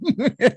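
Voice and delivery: quick amused laugh; short laugh. Real, not acted. Laughs at wigs.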